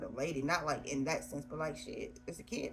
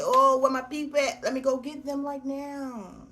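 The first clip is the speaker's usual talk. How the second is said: loud, high, eager cry of "where my people at"; loud and raised, rushing to find them; eager excited call; 6 semitones higher, 8 dB louder than usual. Pretend. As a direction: burst out eagerly, calling loudly for your family. Imagined finding her birth family.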